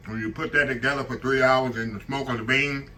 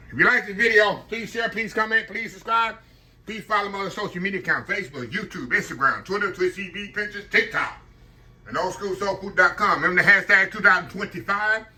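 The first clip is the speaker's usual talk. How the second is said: cheery outro; 6 semitones higher than usual. Acted. Upbeat channel outro read.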